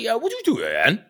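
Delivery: high, quick West Wales cameraman; West Wales accent. Retold or imitated. Cameraman repeating his question, high West Wales accent. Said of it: "very West Wales accent".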